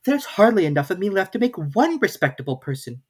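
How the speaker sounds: put-on a girl sighing at herself; Alice's voice. A narrator's reading. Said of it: "thought poor Alice".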